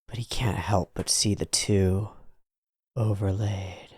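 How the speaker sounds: unvoiced hushed narration; whispering. A narrator's reading. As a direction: whisper the line softly.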